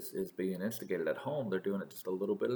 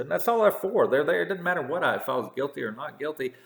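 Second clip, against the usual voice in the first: higher and louder, exasperated; heated rant; 6 semitones higher, 5 dB louder than usual. Real, not acted. Complaining to listeners about the court, heated and loud. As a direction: push it up in frustration.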